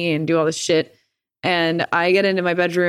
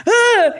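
high sharp cry of disgust, squealed loudly; cringing yelp; 7 dB louder than usual. Retold or imitated. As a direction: squeal it out as you feel the nose give. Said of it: "So I went".

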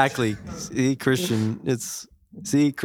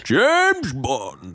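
hammed-up Scottish Bond voice; Sean Connery impression; 2 semitones higher than usual. Host echoing the original James Bond, Scottish Connery impression. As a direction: stretch it out in a mock Scottish accent.

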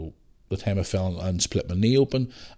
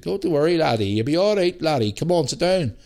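higher, lilting Scottish nurse voice; Scottish woman; 8 semitones higher, 3 dB louder than usual. Retold or imitated. Scottish nurse calming a nervous patient, in a Scottish accent. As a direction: soothe him like a kindly Scottish nurse. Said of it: "this wee Scottish woman".

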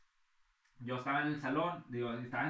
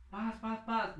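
higher mock shots; imitated gunfire; 8 semitones higher, much slower than usual.

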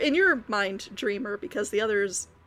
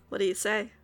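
usual speaking voice

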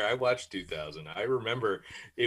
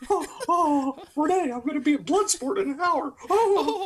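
Moxley Mouse voice; 7 dB louder than usual. Retold or imitated. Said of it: "Moxley Mouse impersonation".

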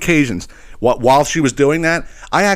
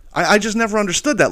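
ordinary talk, nothing marked

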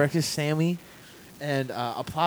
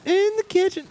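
high, drawn-out mock wail; high whiny wail; 6 dB louder, slower than usual. Exaggerated whine about hanging on.

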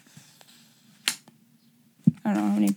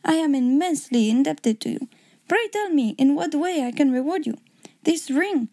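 put-on grateful king with a German accent; lilting German-accented gratitude; 4 semitones higher than usual. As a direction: offer a reward in a German accent. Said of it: "I hope you liked my German accent".

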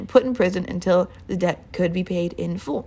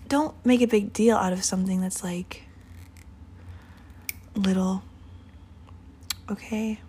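soft unvoiced whisper; 6 dB quieter than usual. Real, not acted. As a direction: drop to a whisper to soften the point. Gentle aside to listeners.

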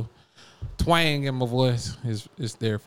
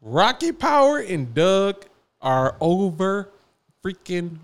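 heated rant; 7 semitones higher, 6 dB louder than usual. Real, not acted. Heated hot take.

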